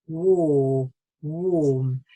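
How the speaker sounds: "warm" with a long, drawn-out or; long vowel. Shown as a demonstration. Teacher shows learners the nice long sound, vowel held. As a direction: stretch "war" and "warm" on a rounded vowel. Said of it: "you get this nice long sound".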